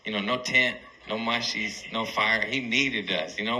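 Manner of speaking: usual speaking voice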